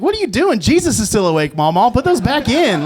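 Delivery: high, laughing mock alarm at grandma; shocked scolding.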